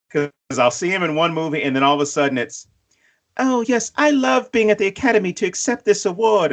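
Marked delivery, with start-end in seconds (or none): 3.4-6.5 high-pitched mock British accent; Tandy Newton, British accent. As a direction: put on a lofty British actress accepting an award. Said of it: "that's how Tandy Newton sounds".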